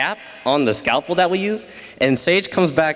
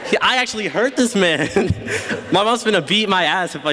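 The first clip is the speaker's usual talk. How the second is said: ordinary delivery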